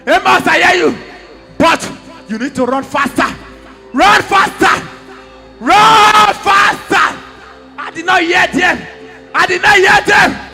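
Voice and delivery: fervent prophetic preaching as the voice of God. Acted. Prophet voicing God to Amos, preaching fervently. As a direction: declare it like a prophet bringing God's word.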